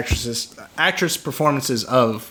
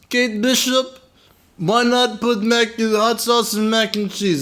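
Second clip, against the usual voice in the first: bad Russian accent; 6 semitones higher than usual. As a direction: send up a terrible movie Russian accent. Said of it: "she has this Russian accent".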